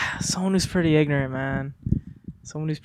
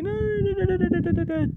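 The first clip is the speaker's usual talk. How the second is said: high soothing no's; faster than usual. Retold or imitated. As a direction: coo no over and over in a high voice. Comforting someone, voiced.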